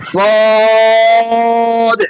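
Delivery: thick, long-held vowel in sad; heavy sad. Shown as a demonstration. Teacher showing students that sad is a heavy letter, elongated demo. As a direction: stretch sad out heavily on one high note. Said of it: "মোটা".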